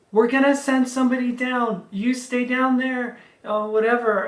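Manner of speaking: high, loud called reply; yelling back. Retold or imitated. Ladies on the road yell down to the trapped driver, loud and high. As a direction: shout it down the slope. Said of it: "they yell back".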